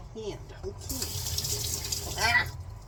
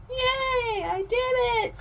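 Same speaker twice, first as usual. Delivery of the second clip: high, loud shriek of joy; excited squeal; 5 dB louder, slower than usual. Real, not acted. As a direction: squeal it out, thrilled. Celebrates success after failing.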